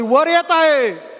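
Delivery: high, drawn-out announcing call; proclamation. Acted. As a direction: call it out slowly like a herald. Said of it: "जसं घोषणा झाली".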